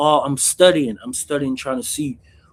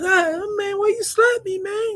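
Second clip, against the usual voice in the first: high, crying mock whine; whiny slapped victim. Inmate who got slapped, whining high at his attacker. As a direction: play the hurt guy who just got smacked, way up high.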